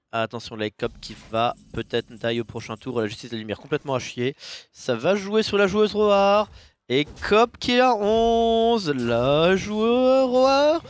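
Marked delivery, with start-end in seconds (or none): 4.7-10.8 loud, high, stretched-out commentary; rising hype on the big play; excited play-by-play. Real, not acted. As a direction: draw out each word as the tension builds. Caster builds to climax of play.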